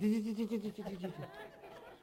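rapid high chipmunk chittering; chipmunk chatter. Retold or imitated. Preacher mimics the missionary's tiny wife, chipmunk chatter. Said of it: "talked like a chipmunk".

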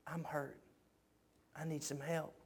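acted hushed plea from a hurting person; hurt, broken plea. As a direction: ask for help softly, as someone broken would. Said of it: "so hurt, so broken".

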